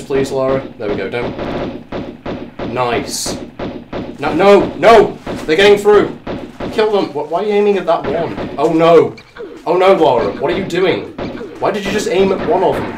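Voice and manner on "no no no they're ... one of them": loud, high-pitched panic; panicked shouting. Real, not acted. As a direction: yell at your character in alarm. Bats attacking, scolding the character.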